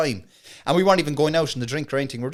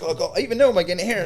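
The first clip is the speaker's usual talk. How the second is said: freaked out; 6 semitones higher, 5 dB louder, faster than usual. Retold or imitated. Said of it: "I fucking freaked out".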